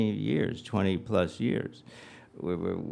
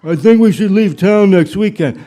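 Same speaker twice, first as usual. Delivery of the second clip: much louder, high booming announcement; mock bold declaration; 9 semitones higher, 16 dB louder than usual. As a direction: announce it grandly as your own idea. Acted proud husband announcing her idea.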